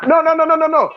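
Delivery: high-pitched rapid string of no's; insistent protest. Real, not acted. Arguing with a fellow panelist, cutting in with high insistent no's. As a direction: cut him off, pitch jumping up.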